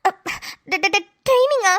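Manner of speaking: slow, stuttered disbelieving question; shocked stammer. Acted. Wife hears of his training, shocked stammer. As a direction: get stuck on the first sound, aghast.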